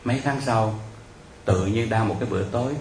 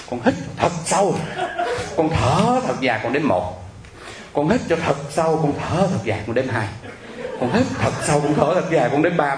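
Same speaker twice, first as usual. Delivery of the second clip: breathing instructions; 4 semitones higher than usual. Retold or imitated. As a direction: lead a panicked caller through measured breaths. Coaching panicked caller through slow breathing. (giọng hướng dẫn hít thở, kéo dài)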